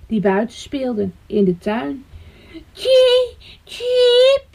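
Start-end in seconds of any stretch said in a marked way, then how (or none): 2.7-4.5 high thin cheeps; frightened chick chirp. A narrator's reading. Chick landing among the children, high scared chirps. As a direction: peep like a fallen baby bird.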